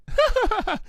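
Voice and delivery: squeaky high-pitched giggle. Acted. Pinkie giggling at Applejack, high squeak. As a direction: giggle like a bubbly pony.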